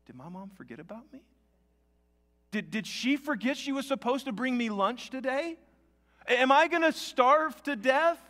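put-on a scared kid fretting, the voice climbing; child's worry turning to alarm; panicky child thoughts. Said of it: "My thought was".

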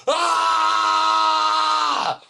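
long high screamed "ah"; loud yell. Retold or imitated. Balcony man testing if drivers hear him, screaming. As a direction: imitate a man screaming at full volume. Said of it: "I'm gonna yell really loud".

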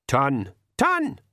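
pixie countdown call; pixie counting. A narrator's reading. Pixies count before firing the crossbow, called-out count.

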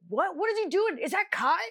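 high, bewildered questions; freaking out. Retold or imitated. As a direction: act out startled confusion. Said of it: "Everyone's freaking out".

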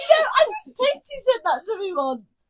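very high, shouted amusement; hysterical outburst. Real, not acted. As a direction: squeal it out, cracking up. Hysterical after being called a pug.

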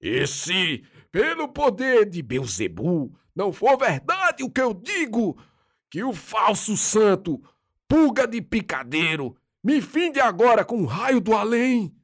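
high barking demonic curse. A narrator's reading. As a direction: bark out the curse possessed. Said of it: "latir interjeições obscenas e continuando".

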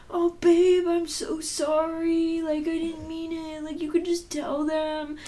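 high, slow, sappy mock apology; imitating her boyfriend's syrupy apology; apologetic boyfriend. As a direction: play the sorry boyfriend, drawn out.

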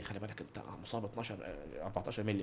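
low, soft doctor's warning; quoted doctor, quiet. Retold or imitated. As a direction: quietly warn about the injury. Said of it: "قال لي".

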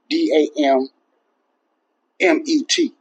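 spelling the curse letter by letter, D A M M E T, each letter named. Shown as a demonstration. Spelling out the curse for viewers, D A M M E T letter by letter. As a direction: spell out D A M M E T one letter at a time, rising high at the end. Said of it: "I didn't say G O D".

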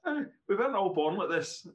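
laughing out a self-mocking joke, high and loud; real laughter at himself. Real, not acted. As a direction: chuckle through it at your own expense. Joke about his lost hair.

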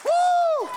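high, ringing shout of joy; preacher's ecstatic falsetto woo; joyful whoop. Real, not acted. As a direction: let out a wild celebratory whoop. Ecstatic cry at the climax.

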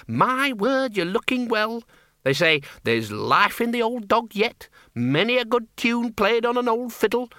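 high sing-song mockery of platitudes; mocking cheerful well-wisher. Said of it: "They always say".

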